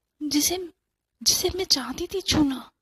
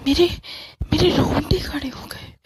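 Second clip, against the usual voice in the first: frightened whisper; 2 semitones higher than usual. Acted. Terrified narration.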